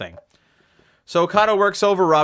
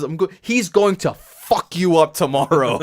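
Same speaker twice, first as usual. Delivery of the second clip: mock Japanese English; 3 semitones higher, 3 dB louder than usual. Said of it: "in perfect English".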